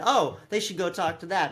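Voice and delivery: ordinary talk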